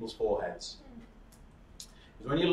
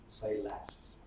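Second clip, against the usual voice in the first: quiet; 4 semitones lower, 11 dB quieter than usual. Shown as a demonstration. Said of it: "Go quiet".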